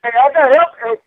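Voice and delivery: loud, high, bursting outburst; excited shout. Real, not acted. Shouting to his friend about the dance, excited. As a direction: yell it out, thrilled.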